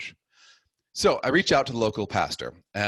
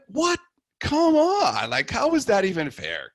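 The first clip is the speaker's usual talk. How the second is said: high, loud, incredulous outburst; indignant protest; 13 semitones higher, 5 dB louder, slower than usual. Retold or imitated. Rejected convert protesting the priest's Bible quiz, indignant. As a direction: protest the unfair question at a high pitch.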